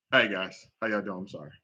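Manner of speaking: ordinary talk, nothing marked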